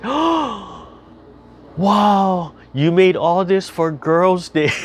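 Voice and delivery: high, slow awed gasp of wonder; child's amazement. Retold or imitated. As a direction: sound like a little girl dazzled by a feast. Granddaughter amazed at dinner.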